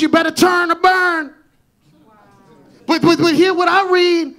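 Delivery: loud, rising preacher's call; full-force preaching. Acted. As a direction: drive it home to the congregation.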